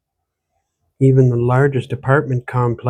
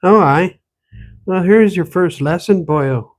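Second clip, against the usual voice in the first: put-on higher, louder scolding in an Irish-tinged accent; old woman scolds the stranger in an Irish-Ontario accent; 5 semitones higher, 4 dB louder than usual. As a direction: tease him in the Irish-Valley accent. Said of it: "The accent she spoke with".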